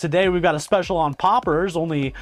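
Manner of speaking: high, bright, rushed restaurant greeter; fake chipper waiter. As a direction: gush at the table like a server. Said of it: "This kind of fake chipperness".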